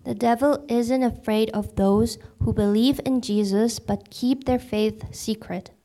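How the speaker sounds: ordinary talk, nothing marked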